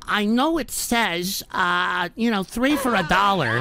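mock cheapskate. Mocks a cheapskate chatter.

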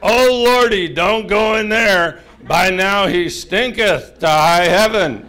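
put-on old-Bible lady wailing a warning; King James lady. Martha warning Jesus off the smelly tomb, theatrically alarmed. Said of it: "she's King James lady".

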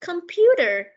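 Computer said high and loud, falling at the end; teacher stresses Computer so pupils catch it; stressed key word. Shown as a demonstration. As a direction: hit the word Computer clearly for the class. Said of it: "Một chiếc máy tính".

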